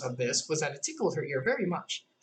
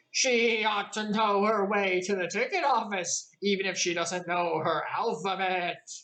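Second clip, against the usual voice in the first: a bit louder, slow bleating goat; 5 semitones higher than usual. A narrator's reading. Goat in the carriage declaring loudly about Alice. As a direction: announce it loudly like a pompous goat. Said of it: "said in a loud voice".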